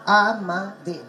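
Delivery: "Amadev" in three evenly weighted beats; every syllable stressed. Shown as a demonstration. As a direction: give A, MA and DEV in "Amadev" equal stress. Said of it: "each syllable has its own stress".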